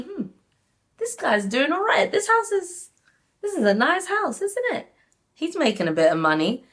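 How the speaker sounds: high, sly, calculating inner voice; scheming money-hungry girlfriend; greedy gold-digger thoughts. Pretend. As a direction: size up his house like you want his money. Imagined gold-digger sizing up house.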